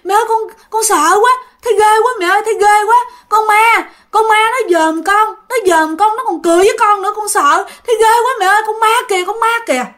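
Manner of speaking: mother imitating her child crying in terror about a ghost; crying terrified child. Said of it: "nó mới vừa khóc mà vừa nói".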